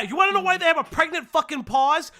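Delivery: fast exasperated shout to chat. Real, not acted. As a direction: hurl it at chat in disbelief.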